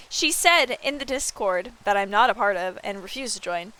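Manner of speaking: ordinary talk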